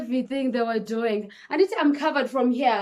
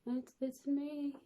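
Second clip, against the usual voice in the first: much softer, slow, drained voice; weak soft reply; 4 semitones higher, 12 dB quieter, slower than usual. Retold or imitated. Paralyzed mother weakly answering the nurse, faint.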